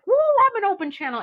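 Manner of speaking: high, loud, fast whoop; mock excitement. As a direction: whoop it out like a thrilled new recruit. Mocks own puffed-up excitement.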